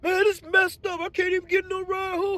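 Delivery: squeaky grateful coworker; coworker's high voice. Retold or imitated.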